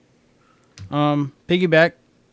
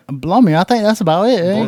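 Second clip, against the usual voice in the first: higher, louder mock British; English accent; 5 semitones higher, 5 dB louder than usual. Host closing the list in a mock English accent. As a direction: wrap it up like a hammed-up Brit. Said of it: "That was brilliant".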